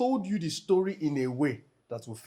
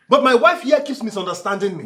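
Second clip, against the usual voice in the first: loud, high, aggrieved outburst; heated protest, pitched up; exasperated complaint; 10 semitones higher, 11 dB louder than usual. Acted. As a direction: raise your voice in frustration. Husband complains to visiting mother.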